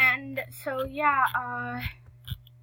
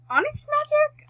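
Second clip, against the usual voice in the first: calling out; 10 dB louder than usual. Real, not acted. Calling her horse in the game.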